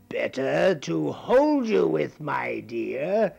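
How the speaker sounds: slow, lightly sly granny; a hint of a wolf in granny's voice; wolf as grandmother. Wolf answering the girl, lightly sly.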